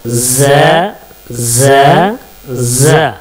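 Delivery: ज as a buzzing z between the teeth; letter demo. Shown as a demonstration. Teacher demonstrating the buzzing letter to students. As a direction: let ज buzz, tongue between the teeth. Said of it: "झंझनाहट की आवाज़ होगी".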